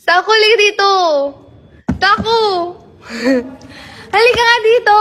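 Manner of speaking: high, loud call across the room; calling the dog. Real, not acted. Calling the dog Tako to come inside, loud and high.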